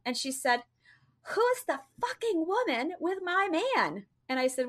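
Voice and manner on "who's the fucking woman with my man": imitating an angry girlfriend, much higher pitch; jealous outburst. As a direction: act out her jealous demand. Jealous girlfriend confronts her.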